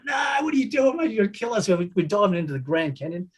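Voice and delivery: high, yelled plea to stop a diving plane; scared passenger yelling up high; panicked shout. Retold or imitated. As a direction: shout it in mock terror at the pilot. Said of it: "So we're terrified".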